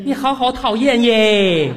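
slow, drawn-out mock gushing; exasperated, stretched-out mimicry. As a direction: parrot the ad lady's sugary style, fed up. Caller mocks the ad's gushing catchphrase.

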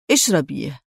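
low-key, stern command; hardened voice. A narrator's reading. Man pressing a woman to drink, hardened voice. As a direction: say it with a hardened edge. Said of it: "قسى صوته".

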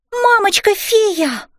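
put-on high, drawn-out child's exclamation; exclaiming. A narrator's reading. Girl spotting a dragon on mum, exclaiming. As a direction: cry out high to alert mum. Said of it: "воскликнула я".

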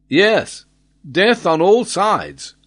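high, drawn-out cry of despair. A narrator's reading. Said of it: "murmured Glenarvan, crushed by despair".

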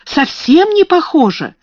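high, loud eager protest; eager denial. A narrator's reading. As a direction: rush to reassure her friend. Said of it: "воскликнула Эрменгарда". (горячее возражение)

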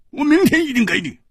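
high, urgent pleading; cornered debtor pleading for time; flustered promise. Acted. Debtor promises old man under pressure.

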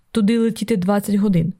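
ordinary delivery, nothing marked

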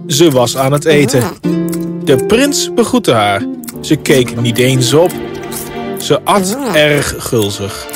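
ordinary delivery, nothing marked

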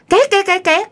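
high, repeated chair screech; imitated chair scraping. Storyteller hears a chair pushed upstairs, high screeching imitation. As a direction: mimic a chair dragged across the floor. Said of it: "nghe tiếng đẩy ghế".

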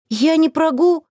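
words choked off, voice climbing; throat tightening mid-word. A narrator's reading. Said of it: "горло вдруг сдавило".

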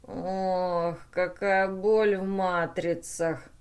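slow, drawn-out moan; pained groaning. A narrator's reading. The battered brain complains of pain, groaning. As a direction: groan through the ache. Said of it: "Мозг поморгал уцелевшими лампочками и застонал".

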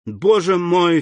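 sudden high cry for help. A narrator's reading. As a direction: cry out in alarm. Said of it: "вскричала Валентина".

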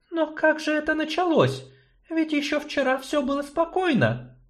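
high, doubtful sorceress; sceptical, measured. Acted. Sorceress doubts gnome's plan, high voice.

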